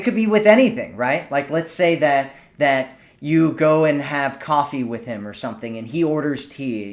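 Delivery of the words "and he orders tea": ordinary talk, nothing marked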